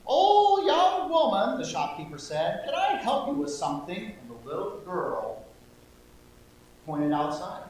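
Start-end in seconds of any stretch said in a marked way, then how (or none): 0.1-1.6 high, louder grandma voice; old lady voice. Acted. Shopkeeper greeting the girl in old lady voice. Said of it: "my old lady voice". 2.7-4.2 higher kindly grandma voice; old lady voice. Acted. Shopkeeper asking the girl in old lady voice. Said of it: "my old lady voice".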